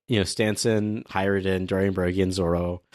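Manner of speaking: usual speaking voice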